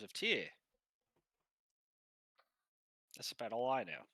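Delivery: blunt, sweary Australian mate; Australian Pilfer. Acted. Pilfer tells companion about Nuke Thunder, Australian accent. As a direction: hold forth as a brash Aussie. Game master plays Pilfer.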